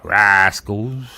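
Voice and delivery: mock Cornish, savouring the word; Cornish accent. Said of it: "as they say in Cornwall".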